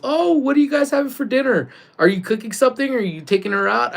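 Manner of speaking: high, chirpy retold phone inquiry; Amy's questioning voice. Retelling Amy's call about dinner, imitated high questioning.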